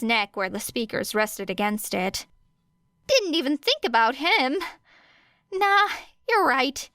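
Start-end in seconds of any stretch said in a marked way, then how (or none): 3.1-6.9 higher, rueful voice; dismayed realisation. A narrator's reading. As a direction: pitch up, caught off guard. Hizashi realising, high.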